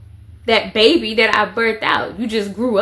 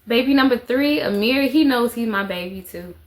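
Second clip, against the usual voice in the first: normal talking voice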